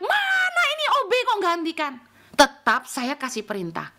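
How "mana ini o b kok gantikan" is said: high, demanding shout; mock outraged call in a raised pitch; imitated yell. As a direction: yell bossily for the cleaner. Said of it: "Tidak teriak".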